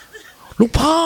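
very high, drawn-out yelled call; mock barked call. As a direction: someone snapping a call at him. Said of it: "ตะโกนตะคอกใส่".

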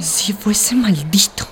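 whispered bitter confession. Acted. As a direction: hush it to your friends. Confessing abuse to friends.

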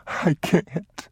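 put-on high, tearful child voice; crying. A narrator's reading. Boy can't stop for his father, crying. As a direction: answer through tears, high-pitched. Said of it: "You have to stop crying".

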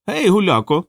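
high-pitched hail; high, hailing call from a hooded figure. A narrator's reading. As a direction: call out to stop a passer-by. Hooded figure calls out to clown.

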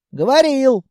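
high, loud-edged testy retort; comic snapping back. A narrator's reading. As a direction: snap back with comic grumpiness. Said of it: "смешно огрызнулся Колобок". (смешно огрызается)

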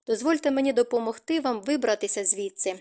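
ordinary delivery

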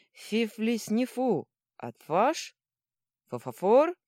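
loud questions with consonants mushed into f; mouth-full lisp. A narrator's reading. Troll bargains with the girl, loud mouth-full mumble. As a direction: speak high and loud round fingers and a fang. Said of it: "полез толстыми пальцами себе в рот".

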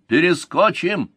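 high, drawn-out bark; barked reply. A narrator's reading. Soldier boasting to his commander about the mountains, barked high and slow. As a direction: bark it out boldly, word stretched. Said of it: "Гед рявкнул".

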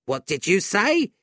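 put-on sudden alarmed old woman; jolted out of her stupor; startled. A narrator's reading.

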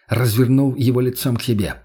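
ordinary delivery, nothing marked

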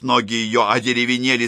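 plain reading voice

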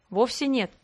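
usual speaking voice

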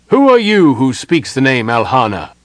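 loud, commanding stranger's voice; stern challenger. A narrator's reading. Hidden elf challenges the intruder, sternly. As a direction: demand an answer, loud and stern.

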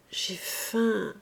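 small, plaintive reply; understated, pitiful little voice; feeble child. Acted. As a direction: answer meekly as a hungry child. Hungry child answers meekly.

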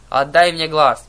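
loud, moaning plea; moaned demand. A narrator's reading. Sister begging for her eye, loud moan. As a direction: groan it out loudly as a demand. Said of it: "простонала Тревога".

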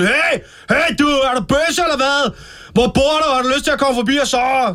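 high-pitched shout down the street; calling after someone. Retold or imitated. Brawler hollering after men going home from town, high shout. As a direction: yell it after people walking away. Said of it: "så råber jeg sådan efter dem".

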